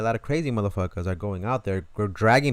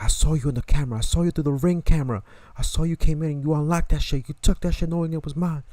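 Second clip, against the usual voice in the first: loud, higher-pitched accusing; angry accusation; 7 semitones higher, 6 dB louder than usual. Retold or imitated. Owner accusing the thief about the camera, loud and higher. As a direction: throw the evidence in his face.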